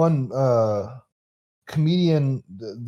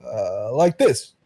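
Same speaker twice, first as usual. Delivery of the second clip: Obama impression. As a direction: pause between phrases like a president addressing the nation.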